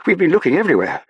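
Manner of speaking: high, loud, impatient scolding; snapping. A narrator's reading. Officer to Saffron after searching the ship, snapping. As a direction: snap it out in exasperation. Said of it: "he snapped".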